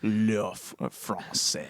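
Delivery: low, soft French accent; mock French. Shown as a demonstration. Host joking about European accents, mock French. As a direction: drop into a put-on French voice.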